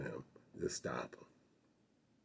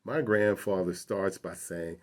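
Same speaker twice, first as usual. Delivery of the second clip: ordinary delivery, nothing marked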